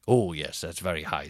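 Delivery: low, indifferent staff reply; unbothered hotel staff. Retold or imitated. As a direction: humour the guest in a deep voice. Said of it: "And they were like".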